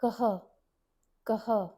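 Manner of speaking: "kaha" with a breathy h at the end; "कः" aspirated visarga. Shown as a demonstration. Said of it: "remember it as an aspirated h sound".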